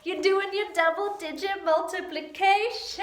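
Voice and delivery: playful big build-up; hyped-up announcement. Pretend. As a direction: sing out the topic with gusto. Hyping up the math section.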